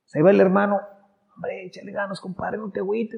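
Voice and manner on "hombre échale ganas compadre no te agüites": consoling brother. Acted. Brother consoling sibling, acted.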